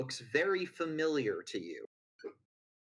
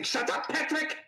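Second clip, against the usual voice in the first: snapping villain; 14 semitones higher than usual. Acted. Villain snaps at assistant.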